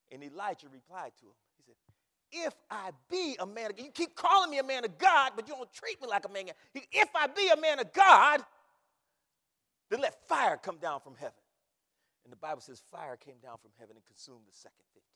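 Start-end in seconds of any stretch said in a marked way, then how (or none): 2.5-11.4 indignant shout, building louder and higher; full-force preaching. Retold or imitated. Elijah answering the captain who orders him down, full-force preaching. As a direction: thunder back as the challenged prophet.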